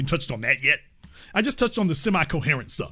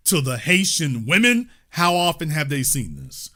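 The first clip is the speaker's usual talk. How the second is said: usual speaking voice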